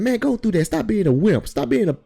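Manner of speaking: high, loud mock badgering; imitating pushy boss. Boss goading a worker through the water, imitated high and loud. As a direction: push hard, as the impatient boss. Boss's pushy order, acted out.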